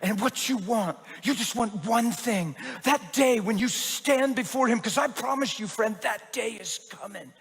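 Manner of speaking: slow, building then hushed preacher's plea; dramatic preaching. Retold or imitated. Pastor stands and preaches to the man, building, then hushed. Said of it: "it was real dramatic".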